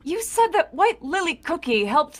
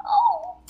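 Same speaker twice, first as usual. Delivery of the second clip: high gasp. Acted. Character gasps at being described.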